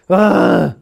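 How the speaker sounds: loud disgusted "ugh" groan. Retold or imitated. Retold inner reaction, groan.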